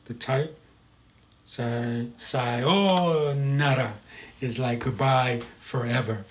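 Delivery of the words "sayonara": "sayonara" with a long drawn-out O; slow "sayonara", stretching the O right out; held O. Shown as a demonstration. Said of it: "you hold that O".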